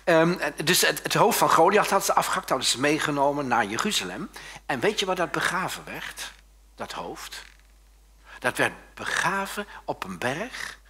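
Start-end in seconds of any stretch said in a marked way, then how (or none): none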